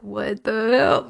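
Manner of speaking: slow, drawn-out disgusted mutter; mock appalled glare; glaring what-the-hell. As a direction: make a judging face as you say it. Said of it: "you're just like, glaring".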